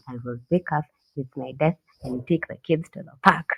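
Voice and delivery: ordinary talk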